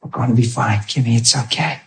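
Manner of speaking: low, soft, calming murmur; smiling reassurance. Retold or imitated. Husband telling worried wife about his leg, soft smiling reassurance. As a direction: reassure her gently with a smile, voice dropped. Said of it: "I remember smiling, saying".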